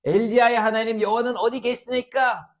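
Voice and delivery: high, loud acted invocation; prophet's cry. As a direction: cry out to God as you strike the water. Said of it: "하고 저도 팍 물을 치매". (하나님을 향해 높이 부르짖는 목소리)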